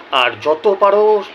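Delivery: normal talking voice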